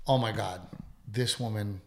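ordinary delivery

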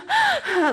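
high, loud sobbing gasp; imitated gasping cry. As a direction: gasp for air as if crying hard. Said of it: "like a gasping for air".

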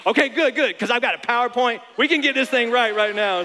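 loud, high, eager fix-it husband; hammed-up keenness, ready with a PowerPoint; husband finally allowed to fix it, excitedly. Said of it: "you're like".

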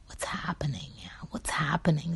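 unvoiced hushed question; whisper. Vlogger asking about the coronavirus in a whisper. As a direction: whisper it worried.

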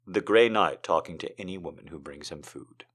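ordinary delivery

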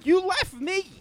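high, loud accusation; indignant yell. Retold or imitated. Accusing the ex who walked out, indignant yell. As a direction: throw the blame back, pitch shooting up. Retelling quarrel with ex.

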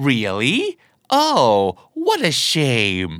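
low, slow "Really?" with fake regret; drawn-out, insincere "what a shame"; sarcastic regret. Shown as a demonstration. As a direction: feign disappointment while secretly relieved. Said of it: "ถ้าอารมณ์น้ำเสียงมาประมาณนี้".

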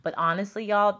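ordinary talk, nothing marked

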